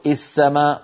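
السماء begun with "i", "is-samaa"; wrong opening kasra. Shown as a demonstration. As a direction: say السماء as "is-samaa", first vowel i. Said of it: "ولا نقول السماء".

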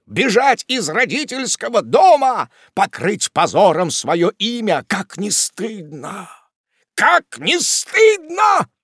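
high, slow, drawn-out outraged rebuke; loud wail of reproach swelling to a shout; scandalised scolding. A narrator's reading. Wardle shames his runaway sister, scandalised scolding.

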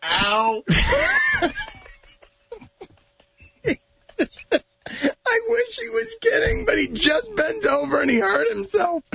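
high, shrieking laugh-cry. Real, not acted. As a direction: laugh so hard it hurts. Host laughing till crying.

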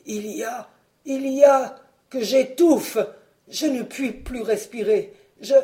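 voix étranglée qui cherche son air; suffocation jouée. Acted. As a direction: dire ça en étouffant, à bout de souffle. Fred suffoque puis s'effondre. (Fred intoxiqué, parle en suffoquant)

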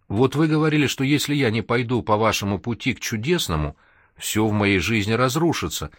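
usual speaking voice

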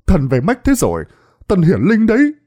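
high, loud I-told-you-so shouting; shrill yelling. Acted. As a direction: crow that he was right, loudly. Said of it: "miệng la lên choe choé". (la choe choé, giọng cao và to)

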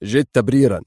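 curt, firm order; a short command that ends the debate; stern. A narrator's reading. Adham orders Hisham as he leaves the car, stern. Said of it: "قال أدهم في صرامة".

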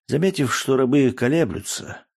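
usual speaking voice

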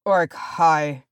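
weighty, dark pronouncement; grim. A narrator's reading. Dwarf leader naming the enemy, grim. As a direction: say it grimly, falling at the end. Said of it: "said Thorin grimly".

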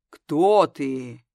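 deep, booming interrogator voice; low rumbling voice. A narrator's reading. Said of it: "изменил голос до низкого, глубинного, ракочущего".

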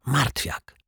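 soft, high correction; dismissive snort. A narrator's reading. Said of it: "prychnął szyld". (ciche, wysokie prychnięcie)

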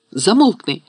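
high, exasperated shout; frantic cry. A narrator's reading. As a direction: cry out to silence him. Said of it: "крикнула Герміона".

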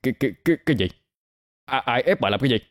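stammered self-defence, caught off guard; flustered stammer. A narrator's reading. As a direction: trip over the first syllables. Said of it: "hắn ta lắp bắp giải thích".

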